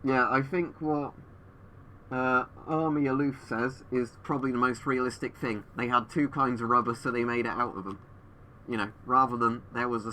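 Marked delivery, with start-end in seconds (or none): none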